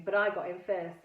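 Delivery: ordinary delivery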